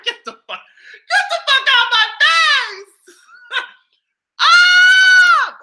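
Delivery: high, slow laughing shriek. Real, not acted. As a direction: squeal through laughter.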